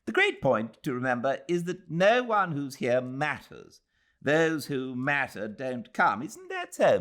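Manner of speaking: lightly voiced pompous young man; Ronnie's voice. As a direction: lay down the law to the ladies. Ronnie's speech in novel.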